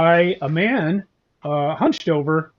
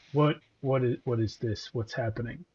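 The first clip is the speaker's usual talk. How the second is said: quiet masked warden; 4 semitones lower, 10 dB quieter than usual. Acted. GM voices masked Spirit Warden.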